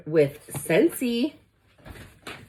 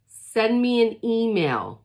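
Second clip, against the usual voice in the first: usual speaking voice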